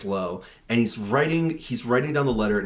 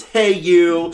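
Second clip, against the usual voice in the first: mock tough callout; 5 semitones higher, 9 dB louder than usual. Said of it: "George comes in and goes".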